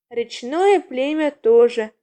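usual speaking voice

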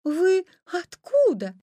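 high, slow, wondering question. A narrator's reading.

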